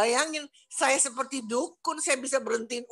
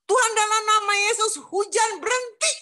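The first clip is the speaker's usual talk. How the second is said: high-pitched, forceful prayer command; retold prayer command; 10 semitones higher, 4 dB louder than usual. Woman retelling her prayer against the rain, high commanding voice. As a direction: order it in prayer, voice raised high. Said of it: "langsung saya bilang ini".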